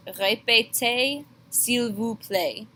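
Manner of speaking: Répétez, s'il vous plaît with rhyming ay endings, slow; teacher modelling for students; slow French demo. Shown as a demonstration. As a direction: let pétez and plaît rhyme. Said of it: "Répétez and play rhyme with each other".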